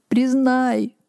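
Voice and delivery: high, drawn-out mild wail; wailing plea. A narrator's reading. Old man begging son to acknowledge him, wailing. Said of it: "вопил старик".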